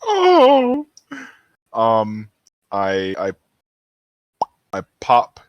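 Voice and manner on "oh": squealed oh of sympathy; high cooing oh. Real, not acted. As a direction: let out a high, loud aww. Player reacting to troll.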